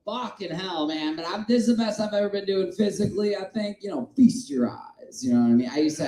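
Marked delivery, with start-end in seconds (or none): none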